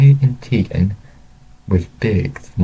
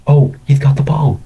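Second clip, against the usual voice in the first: higher, louder burst of game excitement; excited sports fan; 7 semitones higher, 5 dB louder than usual. A narrator's reading. Man watching football with his friend, excited. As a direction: call out the big play eagerly.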